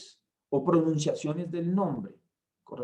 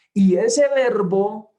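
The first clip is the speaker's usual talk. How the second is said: "ese Verbo" slow, much louder and higher; stressed phrase; 6 semitones higher, 11 dB louder, slower than usual. Shown as a demonstration. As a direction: hammer "ese" slowly. Preacher stressing the Word. ("ese Verbo" lento y muy fuerte)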